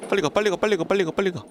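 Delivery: high, rapid frantic urging; panicked hurry. Real, not acted. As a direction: beg it to move, scared. Panicking to escape.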